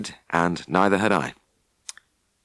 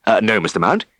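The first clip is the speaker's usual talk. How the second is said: eager phone voice; 5 semitones higher, 4 dB louder than usual. Acted. Tristan eager on phone with farmer.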